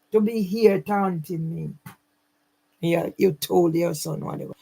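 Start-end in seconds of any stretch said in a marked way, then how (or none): none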